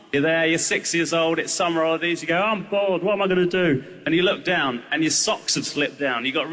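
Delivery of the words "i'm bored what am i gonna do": put-on bored six-year-old. Child voiced in story.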